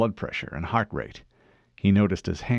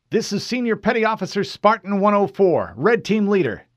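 higher, louder formal report; Fred reporting to an unknown officer, formal; 13 semitones higher, 5 dB louder than usual. Acted. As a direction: state rank crisply. Fred identifies himself.

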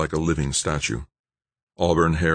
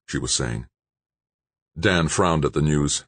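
plain reading voice

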